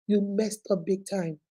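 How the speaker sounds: quiet disappointed echo. Real, not acted. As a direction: drop the voice and drive it home.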